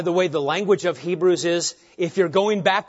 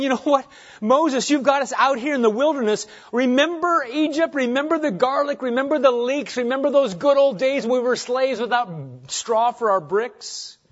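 high-pitched, hammed-up grumbling; mock wistful whining, pitched well up; mock nostalgic complaint; 9 semitones higher, 4 dB louder than usual. Hebrews grumbling to Moses in the wilderness, hammed-up complaint.

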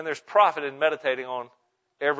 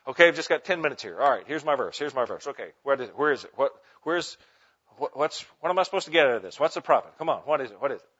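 hurried, impatient muttering to oneself; rushing; faster than usual. Acted. Bible reader with ten minutes hunting for a verse, rushing. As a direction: race through it, restarting questions as if pressed for time. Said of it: "keeps you from rushing through the text".

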